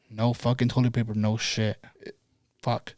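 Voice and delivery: low, quiet, fed-up grumble; sullen retold anger. Man finding no toilet paper, low sullen anger. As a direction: grumble it softly, beaten and annoyed. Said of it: "I'm fucking mad as fuck".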